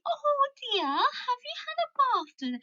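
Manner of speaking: very high, hammed-up English accent; mock English accent in a high voice; exaggerated English accent. Shown as a demonstration. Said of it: "it's not like".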